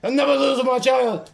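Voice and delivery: high, loud sustained shout; screamed vow. Real, not acted. Player vowing to keep the child, screamed vow. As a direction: shout it at full force, pitched high.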